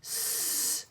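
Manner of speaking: long soft hissed S; stretched S. Shown as a demonstration. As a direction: hold the S like a quiet hiss. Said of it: "What's the first sound you hear?".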